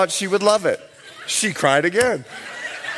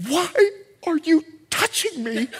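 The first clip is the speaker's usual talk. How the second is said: annoyed wife in labour; 7 semitones higher than usual. Retold or imitated.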